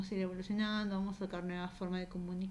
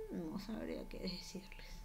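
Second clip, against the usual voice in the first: whispered aside; 4 semitones lower, 11 dB quieter than usual. Real, not acted. Dodges awkward question aside.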